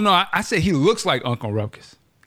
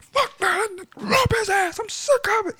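much higher hammed-up bigot voice. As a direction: play a sneering Klansman, pitched way up. Imitating racist ranting.

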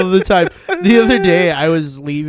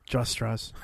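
deadpan; 3 semitones lower, 8 dB quieter than usual. Retold or imitated. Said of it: "he's like".

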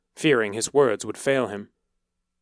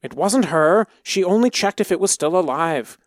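desperate plea; 7 semitones higher, 5 dB louder than usual. A narrator's reading. Boy confesses to save Sarah.

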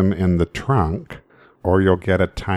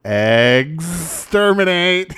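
Dalek; 7 semitones higher, 4 dB louder, much slower than usual. Acted. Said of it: "What do Daleks like having for breakfast?".

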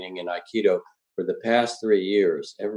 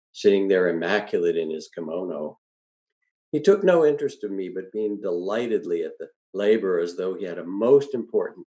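usual speaking voice

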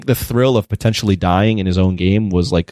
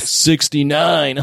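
drawn-out, higher-pitched VIP impression; mock VIP character; 6 semitones higher, much slower than usual. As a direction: stretch it out slowly like a hammy character. Mimicking the American VIP characters.

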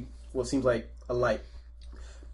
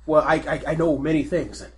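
higher and louder, pleading in panic; desperate begging; 4 semitones higher, 8 dB louder than usual. Acted. Fredrickson begging the captain to spare him, panicked. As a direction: beg for your life. Fredrickson begs for his life.

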